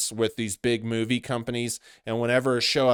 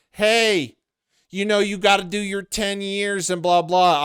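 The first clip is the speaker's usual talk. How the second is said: higher, louder mock lecture; lightly voiced naysayer; 8 semitones higher, 5 dB louder than usual. Host imitating people who say pay your dues, lightly mocking. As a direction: lightly mimic someone calling out advice.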